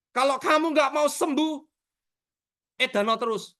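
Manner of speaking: high-pitched mock scolding; retold angry threat. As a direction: re-enact scolding a stubborn patient. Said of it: "Gara-gara saya marah". (menirukan omelan marah)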